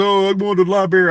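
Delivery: high, quick mocking quote; mimicking mispronouncers. Quoting people he heard say it wrong, high mimicry. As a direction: imitate someone breezily saying library as "lie-berry". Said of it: "I heard people say".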